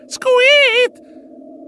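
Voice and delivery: very high, loud squeak; sudden piercing squeal; mouse squeak. Acted. Mouse narrator startled in the factory, high squeak.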